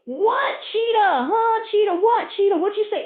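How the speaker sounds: very high, loud, panicky questioning; hard-of-hearing gazelle. Acted. Deaf gazelle asking about the cheetah, frantic high shouting. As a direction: shout each question like you can't hear. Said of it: "the motherfucker that can't hear is in trouble".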